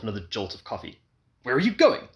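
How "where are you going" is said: high, loud alarmed question. A narrator's reading. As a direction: call after her, worried. Husband alarmed.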